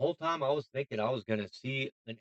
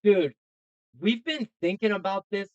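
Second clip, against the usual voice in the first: excited realization; 6 semitones higher, 4 dB louder than usual. Real, not acted. Excited realization about aliens.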